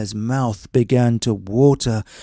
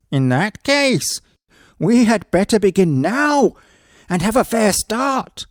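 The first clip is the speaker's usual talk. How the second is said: high, louder eager husband; woodman deciding; 9 semitones higher, 4 dB louder than usual. Acted. Woodman tells his wife to start eating now, high. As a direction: hurry to eat first, higher.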